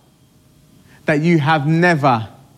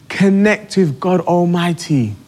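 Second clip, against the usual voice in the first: normal talking voice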